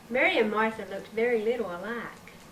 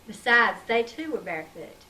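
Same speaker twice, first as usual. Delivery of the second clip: usual speaking voice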